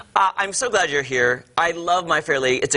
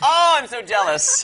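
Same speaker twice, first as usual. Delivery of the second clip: high, drawn-out laughing exclamation; playful envy; 7 semitones higher than usual. Real, not acted. Host envying actress's accent, delighted and laughing. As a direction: gush with laughing, admiring jealousy.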